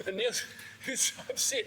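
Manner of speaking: laughing through the words; can barely get it out for laughing. Real, not acted. Remembering Neil upset at the bar, laughing while telling it.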